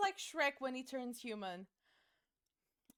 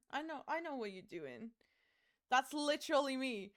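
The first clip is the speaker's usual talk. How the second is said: usual speaking voice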